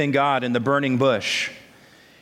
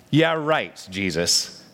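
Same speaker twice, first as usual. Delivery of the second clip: dry, disbelieving scoff dropping low on the name; sarcastic retort; 5 semitones lower, slower than usual. Acted. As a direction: roll your eyes at the reassurance. Disciples' sarcastic retort to Jesus.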